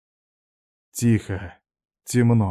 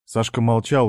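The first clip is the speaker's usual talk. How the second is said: ordinary delivery, nothing marked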